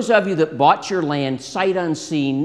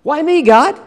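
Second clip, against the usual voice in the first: high-pitched, incredulous plea to God; acted disbelieving protest; 12 semitones higher, 7 dB louder than usual. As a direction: play a reluctant man balking at the call. Moses protesting God's call in retold dialogue.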